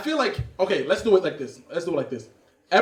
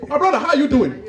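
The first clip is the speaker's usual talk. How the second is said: put-on higher-pitched African accent greeting; 5 semitones higher than usual. Two Africans meet after a while, hearty African accent. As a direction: greet a long-lost friend in an African accent. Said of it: "Two Africans that haven't seen each other".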